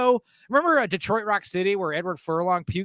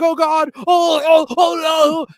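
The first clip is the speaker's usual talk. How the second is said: loud, high, hammed-up wailing; put-on tearful panic shout; mock crying; 9 semitones higher, 8 dB louder, much slower than usual. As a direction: wail in fake horror. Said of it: "like, crying".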